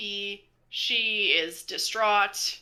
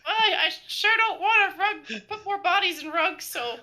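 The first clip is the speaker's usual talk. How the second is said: put-on higher, eager boyish voice; wholesome 1950s kid; 10 semitones higher than usual. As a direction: sound like a naive young kid. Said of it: "Is this guy twelve?".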